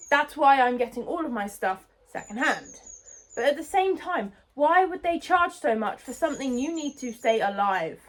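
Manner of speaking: ordinary talk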